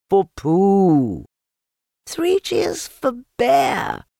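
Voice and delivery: slow, high, loud cheering. Acted. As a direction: cheer it out slowly. Cheering chorus.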